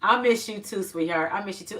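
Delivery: usual speaking voice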